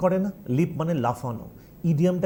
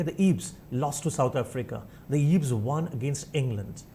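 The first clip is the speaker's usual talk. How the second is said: slow headline reading; newspaper headline read slowly; much slower than usual. Shown as a demonstration. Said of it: "newspaper লেখে".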